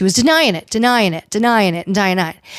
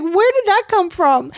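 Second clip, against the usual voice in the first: high mock bafflement; 8 semitones higher than usual. Cast voiced in shock.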